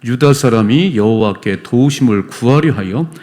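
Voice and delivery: slow, loud unison Bible reading; congregational scripture reading. Real, not acted. Pastor leading church in reading Chronicles, slow and loud. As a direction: read it together, measured and slow. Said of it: "같이 읽어봐요".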